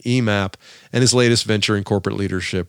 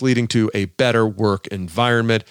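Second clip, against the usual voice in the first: normal talking voice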